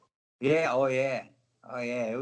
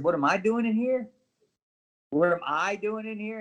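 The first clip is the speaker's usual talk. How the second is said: mock indignation; 5 semitones higher, 3 dB louder than usual. Indignant repeat to NXT guys.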